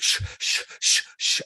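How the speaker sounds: voiceless shushing breaths while running. Retold or imitated. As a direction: huff out breath sounds like sprinting. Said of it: "you hear me running like".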